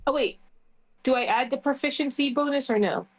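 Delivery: usual speaking voice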